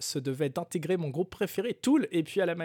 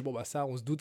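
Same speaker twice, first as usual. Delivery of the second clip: usual speaking voice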